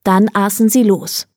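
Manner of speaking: usual speaking voice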